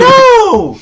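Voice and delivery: high, loud shriek of outrage. Real, not acted. Host ranting about Italian bars to co-host, shrieking no. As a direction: screech it in mock fury.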